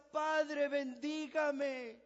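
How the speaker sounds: loud, high cry, then slow stretched bendígame; weeping son begging for a blessing; crying plea. Acted. Weeping Esau begs his father, loud and slow. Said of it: "el tipo empieza a llorar".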